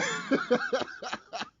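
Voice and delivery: real unvoiced laugh bursting out; cracking up at his own joke; breathy laugh. Real, not acted. Laughing at his own movie idea, breathy.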